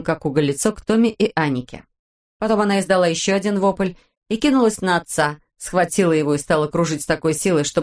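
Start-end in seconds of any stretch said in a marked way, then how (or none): none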